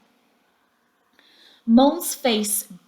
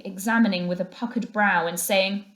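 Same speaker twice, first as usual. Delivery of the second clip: ordinary delivery, nothing marked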